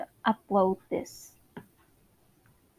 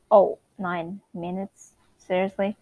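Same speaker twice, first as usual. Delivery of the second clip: normal talking voice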